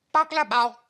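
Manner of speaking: put-on high, loud toddler try at the name; baby voice. Baby repeating the burglar's name, high toddler voice. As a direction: say it like a small child.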